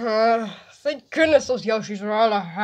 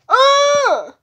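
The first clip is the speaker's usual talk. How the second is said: ordinary talk, nothing marked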